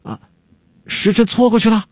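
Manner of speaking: high-pitched alarmed question; panicked. A narrator's reading. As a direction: blurt it out in startled alarm. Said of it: "方术士惊慌地问". (惊慌地高声问)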